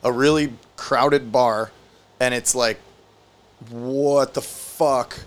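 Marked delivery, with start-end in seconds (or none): 3.7-5.2 slow, drawn-out stunned question; stunned disbelief. Retold or imitated. Friend reacting to a collapse in a crowded bar, slowly stunned. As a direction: retell a stunned reaction, stretching each word.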